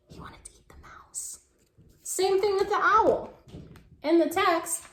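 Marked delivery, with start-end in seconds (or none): 0.1-1.4 secretive whisper. Real, not acted. Teacher whispering the secret to children. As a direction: let them in on the secret.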